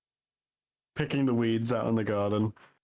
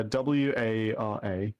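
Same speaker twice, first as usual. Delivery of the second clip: slow spelling; slower than usual. Shown as a demonstration. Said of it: "One more time".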